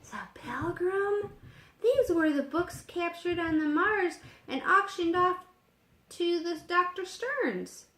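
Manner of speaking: suddenly lit up by the find; a boy thrilled at what he's found; excited discovery. A narrator's reading.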